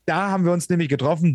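ordinary talk, nothing marked

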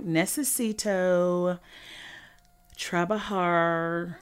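slow, drawn-out classroom Spanish reading; mock a teen sounding out Spanish word by word; stilted school Spanish. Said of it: "why she was talking like that".

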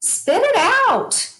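rally them to speak up, full conviction; fired-up outburst. Real, not acted.

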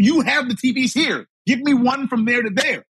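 high-pitched, insistent retold complaint; exasperated arguing. Retold argument with store employee.

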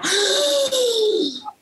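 high panicked gasp; sharp gasp. Acted. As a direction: suck in air in panic. Panicking woman gasps.

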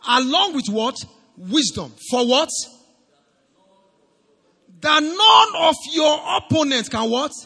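loud, rising questions for the crowd; call-and-response preaching. Acted. Preacher leading the congregation through the verse, loud and rising. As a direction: pull the answers out of the church.

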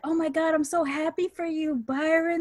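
high gushing praise, mock-enthusiastic; high-pitched excitement for a friend; gushing cheerleader. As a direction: gush like a cheerleader. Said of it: "I'm still just like".

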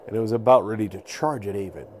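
usual speaking voice

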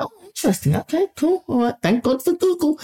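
very high, sweetly agreeable voice; fake politeness. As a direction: mask the outrage with high-pitched pleasantries. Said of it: "obviously, I'm not an idiot".

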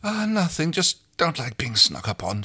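mildly nervous answer, starting high; understated jittery denial. A narrator's reading. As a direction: brush it off with a touch of nerves. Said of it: "she answered nervously".

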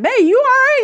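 high, loud acted check-in; alarmed concern. Husband checks on her, acted.

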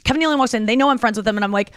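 ordinary delivery

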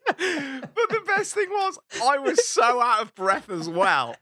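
loud, slow, high through laughter; gleeful, laughter-stretched telling; laughing hard. Real, not acted. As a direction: force the words out while cracking up. Laughing through own story.

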